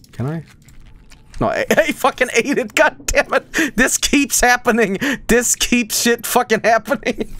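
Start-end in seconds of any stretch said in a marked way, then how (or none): 1.4-7.3 exasperated. Real, not acted. Gamer ate it by mistake, exasperated. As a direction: complain upward in exasperation. Lost item by eating it again.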